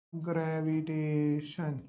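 low, very soft, stretched syllables; writing aloud. Real, not acted. Teacher finishing the corrected term on the board, slow and hushed. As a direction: draw the word out slowly under your voice. Sounding out word while writing.

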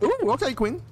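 normal talking voice

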